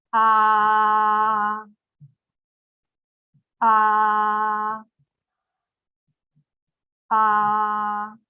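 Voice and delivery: "Ah" sustained on one level pitch, each held; first tone drill. Shown as a demonstration. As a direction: model "Ah" flat and even for students to repeat. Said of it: "please repeat after me".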